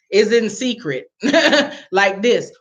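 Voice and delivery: talking through a laugh; real laughter. Real, not acted. Telling viewers about the secretive money handover, laughing. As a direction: laugh between the words.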